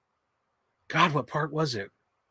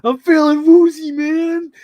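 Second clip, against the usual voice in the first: much higher, louder dazed slacker; Matthew Lillard; 14 semitones higher, 10 dB louder, slower than usual. Retold or imitated. Quoting the movie Scream to viewers, much higher, louder and dazed. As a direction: whine it woozy and loud. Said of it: "like Matthew Lillard in Scream".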